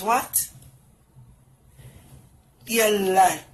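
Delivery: ordinary talk, nothing marked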